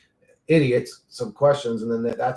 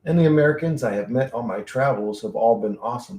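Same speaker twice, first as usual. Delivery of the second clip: normal talking voice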